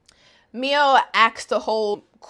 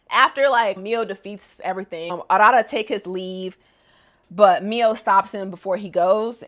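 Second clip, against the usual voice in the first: normal talking voice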